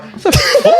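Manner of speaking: high-pitched, breaking into laughter; laughing. Real, not acted. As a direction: squeal it through real laughter. Laughing over the account theft story.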